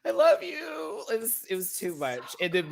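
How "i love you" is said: very high imitated whimper; high-pitched mock devotion; mock lovesick. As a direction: plead in a high, hammed-up lover's voice. Voicing lovesick woman from episode.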